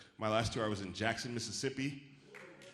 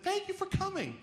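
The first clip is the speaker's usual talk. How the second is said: high, over-eager thank-you. Retold or imitated. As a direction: gush your thanks. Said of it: "this woman came up and said".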